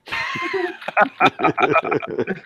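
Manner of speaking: high shrieking laugh that breaks into a shout; big real laugh rising into a shout. Real, not acted. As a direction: let the laugh explode way up high. Laughing at own cousins joke.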